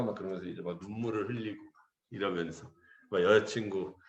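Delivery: ordinary talk, nothing marked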